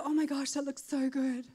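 mock craving. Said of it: "I wouldn't go".

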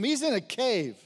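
normal talking voice

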